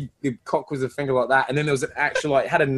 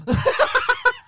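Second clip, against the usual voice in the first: a sudden high squeal of a laugh; high burst of laughter; 3 dB louder than usual. Real, not acted. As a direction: let out a real squealing laugh. Laughs at friend's joke.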